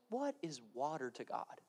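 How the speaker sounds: normal talking voice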